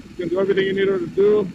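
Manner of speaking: excited. Real, not acted. Excited at top speed on highway.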